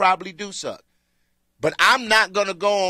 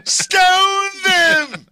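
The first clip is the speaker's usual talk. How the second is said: loud, slow, high Roman emperor; Caesar at the Coliseum; 12 semitones higher, 8 dB louder, much slower than usual. Retold or imitated. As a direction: bellow like an emperor at the games. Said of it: "Like Caesar had his hand up".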